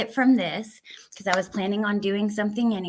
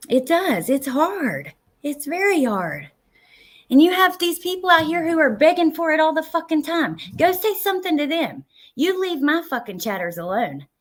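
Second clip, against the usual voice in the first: higher, louder, indignant scolding; angry rant; 8 semitones higher, 3 dB louder than usual. Real, not acted. Streamer scolding chat beggers, angry and loud. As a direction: tell them off for picking on your viewers. Rant against begging chatters.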